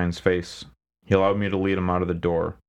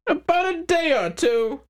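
smug crime boss. Acted. McHines voiced character.

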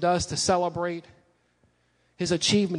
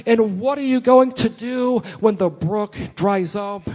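preaching at full force; 3 semitones higher, 4 dB louder than usual. Acted. Preaching climax.

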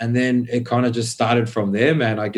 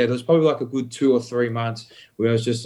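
ordinary talk

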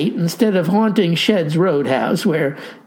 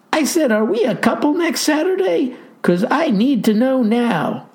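higher, pressing gal voice; young woman voice; 7 semitones higher than usual. A narrator's reading. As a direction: push him for an answer. Clarissa demands a date.

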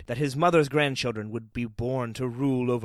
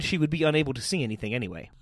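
usual speaking voice

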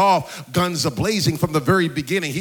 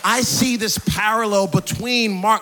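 impassioned preaching; 5 semitones higher, 4 dB louder than usual. Real, not acted. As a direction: preach it with rising fervour, higher and louder towards the end. Preacher builds to full force.

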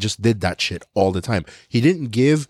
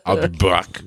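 a hint of Arnold's low movie line; half-hearted Terminator quote; lightly voiced Arnold; 4 semitones lower, slower than usual. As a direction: drop into a light Arnold impression.

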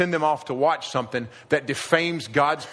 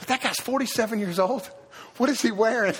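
much higher, incredulous jeer; mock scoffing onlooker; 8 semitones higher than usual. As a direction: scoff at the aging hipster. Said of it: "We've all seen that guy".

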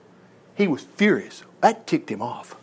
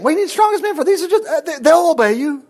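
incredulous; 10 semitones higher, 3 dB louder, faster than usual. Real, not acted. Preacher mocking the king's excess.

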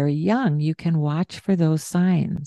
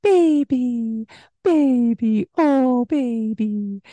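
high, slow cooing; baby talk; 6 semitones higher, slower than usual. Shown as a demonstration. Parent showing the soothing baby talk. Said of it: "this baby talk way that we talk".

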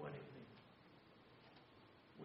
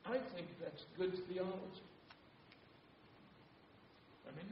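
ordinary talk, nothing marked

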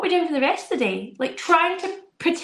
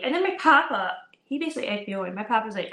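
normal talking voice

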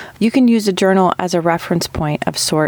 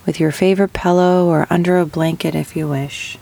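unhurried meditation guide, long pauses between phrases; guided meditation. Acted. Host guiding listeners with chronic pain into rest, guided meditation. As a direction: ease them gently into breathing, drawing out key words.

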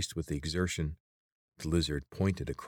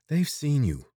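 put-on much higher, louder girl voice; urgent Teek; 9 semitones higher, 6 dB louder, slower than usual. A narrator's reading. Teek urges Corvin to hide the light, high and urgent. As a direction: scold him quickly to stop.

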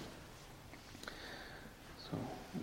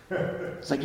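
ordinary talk, nothing marked

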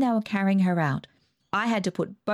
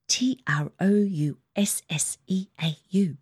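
breathy unvoiced spelling of a secret word; spelled-out whisper; 3 semitones higher than usual. A narrator's reading. Narrator whispering letters to her sister. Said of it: "spelled out on my fingers".

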